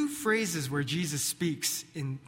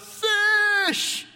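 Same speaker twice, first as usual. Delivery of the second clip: a loud yell, far higher, like a sudden catch; 10 dB louder than usual. Acted. James calling out as the nets fill, in a loud high yell. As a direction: yell it out in surprise across the water. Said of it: "And James yells out".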